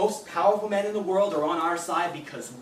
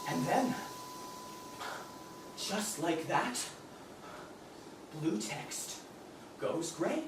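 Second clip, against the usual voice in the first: softer, slow and tense; hushed stillness; 12 dB quieter, slower than usual. Acted. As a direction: hold breath as it vanishes. Account deleted, hushed pause.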